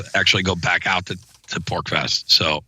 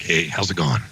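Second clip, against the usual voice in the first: lower, rough laid-back hello; low gruff greeting; 7 semitones lower than usual. Shown as a demonstration. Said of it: "I'm not like".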